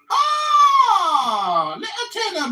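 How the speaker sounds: high, loud mocking "Oh"; mock gush. Taunting Tuna Boy with a mock gush. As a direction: gush it in mockery.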